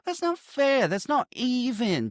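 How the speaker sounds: high, drawn-out complaint; hammed-up high-pitched grievance; mock indignant protest. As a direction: protest like you have been cheated, slowly.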